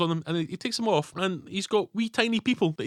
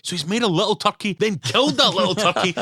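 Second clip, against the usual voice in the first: high, rising incredulous rant; mock disbelief; 8 semitones higher, 4 dB louder than usual. Viewer reeling at the little turkey, high and incredulous. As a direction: build up the disbelief to a near cry. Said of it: "and you're like".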